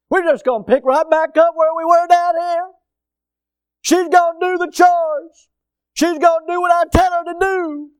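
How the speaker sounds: high, loud mock declaration in a put-on voice; mocking entitled husband. Mocks husbands' idea of heaven.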